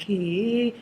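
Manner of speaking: mother's drawn-out, sympathetic lament; pitying mother. Acted. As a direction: pity the poor girl, stretching the words. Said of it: "the mother will be like".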